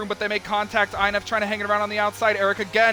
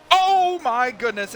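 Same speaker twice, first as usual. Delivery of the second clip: surprised gasp; 6 semitones higher, 5 dB louder, much slower than usual. Real, not acted. Near crash seen live.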